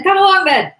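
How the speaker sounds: very high, loud echo of the dare; second challenger's shout. Acted. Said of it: "repeated one after the other".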